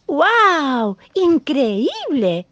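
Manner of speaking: high, loud and drawn-out wonder; amazed exclaiming. Acted. Cub amazed when his dad covers his eyes, slow amazed exclaim. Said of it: "exclamó el leoncito".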